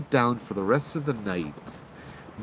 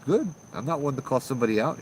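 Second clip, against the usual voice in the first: ordinary talk, nothing marked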